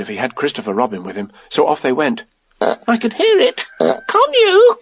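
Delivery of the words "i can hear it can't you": high, squeaky, nervous question; anxious. A narrator's reading. Piglet as they got near the trap, anxious. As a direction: squeak it anxiously, rising at the end. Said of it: "said Piglet anxiously".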